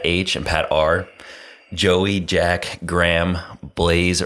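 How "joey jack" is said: ordinary delivery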